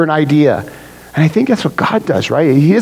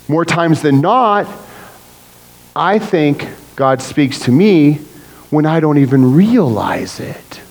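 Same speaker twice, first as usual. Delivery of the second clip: ordinary delivery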